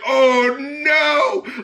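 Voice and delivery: high mock alarm; mock surprise. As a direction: gasp it in fake shock. Mocking Mariah.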